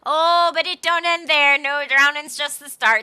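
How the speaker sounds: loud, high-pitched sea-dog storytelling; old sailor. Acted. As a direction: spin a grim sea yarn with gusto. Resumes the old sailor's tale.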